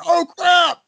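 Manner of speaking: high, shrieking mock panic with a sob. Techs panicking that everyone gets fired. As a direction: squeal it like the sky is falling. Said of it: "they're like".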